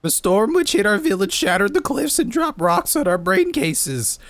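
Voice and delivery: high creature voice. Acted. Ready Rock NPC dialogue voiced.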